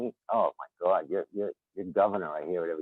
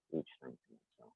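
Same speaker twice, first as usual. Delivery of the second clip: much softer, trailing repeat; soft echo; 7 semitones lower, 18 dB quieter than usual. Real, not acted. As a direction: repeat it almost to yourself. Repeats phrase, second time softer.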